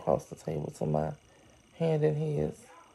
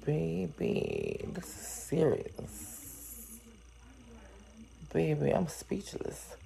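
hushed disbelief; 13 dB quieter, much slower than usual. Real, not acted. Stunned reaction to story.

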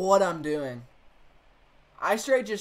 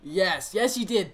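ordinary talk

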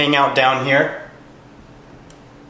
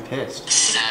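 whispered; 4 dB quieter than usual. Real, not acted. Whispering during investigation.

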